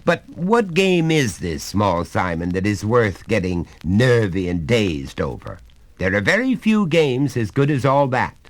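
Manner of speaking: a hearty father coaxing his son; genial father. Acted. Father's questioning, raised voice.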